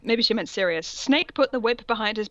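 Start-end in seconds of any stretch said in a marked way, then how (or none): none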